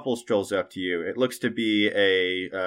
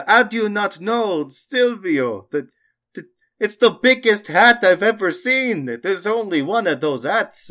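high, loud, incredulous merchant voice; astonished merchant; 11 semitones higher, 7 dB louder than usual. Acted. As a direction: exclaim in disbelief as the merchant.

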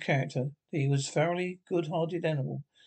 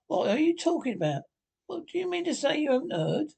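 high-pitched agitated questioning; rising disbelief, pitched well up; greatly excited; 10 semitones higher than usual. A narrator's reading. Rat questioning Toad about the news, greatly excited. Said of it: "getting greatly excited".

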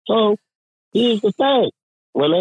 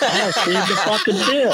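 half-laughing, raised outburst; laughing exclaim; 4 semitones higher than usual. Retold or imitated. Telling friends how he caught the thief, laughing outburst. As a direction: crack up while calling out the lie. Retelling confronting the lying worker.

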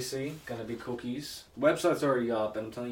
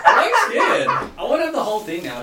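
laughing excitedly; 18 dB louder than usual. Real, not acted. Laughing excitedly before tasting.

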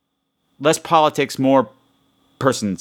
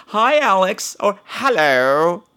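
higher, drawn-out British hello; mock British accent; 5 semitones higher, much slower than usual. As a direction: greet him in a hammed-up British accent. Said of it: "as you people say over there".

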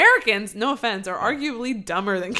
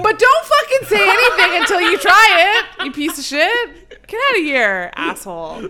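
loud, high, laughing mock fury; hammed-up outrage, laughing through it; mock outraged rant; 11 semitones higher, 11 dB louder than usual.

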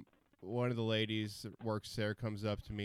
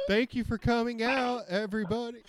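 high, louder stage thanks; squeaky announcer thanking crowd; high nervous greeting; 12 semitones higher, 5 dB louder than usual. Retold or imitated.

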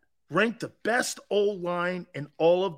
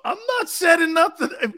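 mock protest; 10 semitones higher, 5 dB louder than usual. Denies setting them up.

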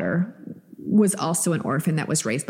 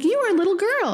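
much higher, childlike imitation of her son; little son's voice; 14 semitones higher than usual. Mom retelling her son's words to podcast cohost, in a high childlike voice. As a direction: pitch way up to play her little boy. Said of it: "He's like".

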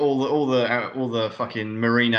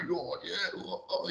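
soft, higher-pitched mock stammering; hushed, put-on flustered noises; Chief O'Hara; 6 semitones higher, 12 dB quieter than usual. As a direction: imitate a twitchy, overreacting sidekick. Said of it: "facial spasms. They're like".

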